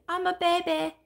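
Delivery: lightly voiced baby doll, high pitch. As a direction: speak up high as a little doll. Voices the baby doll she holds.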